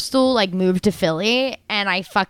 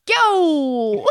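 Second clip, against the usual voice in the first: put-on Brooklyn accent; 5 semitones higher than usual. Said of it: "Do a Brooklyn accent".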